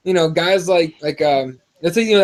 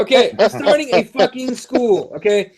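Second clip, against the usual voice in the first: laughing; 4 semitones higher than usual. Real, not acted. Mocking the fighters' nicknames.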